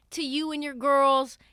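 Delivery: ordinary delivery, nothing marked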